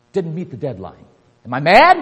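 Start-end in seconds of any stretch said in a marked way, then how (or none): 1.4-2.0 louder rhetorical question. Acted.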